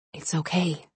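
soft hushed whisper. A narrator's reading. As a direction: soothe him in a whisper. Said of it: "she whispered".

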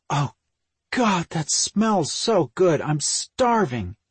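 high, slow, drawn-out relish; ravenous gushing. A narrator's reading. Hungry sister smelling dinner, gushing. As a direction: savour each word, starving.

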